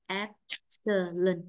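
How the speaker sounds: slow "Excellent" split into EX-cel-lent; syllable by syllable. Shown as a demonstration. Teacher confirming first-syllable stress for the student, slow syllabified demo. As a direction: break "Excellent" into separate drawn-out syllables.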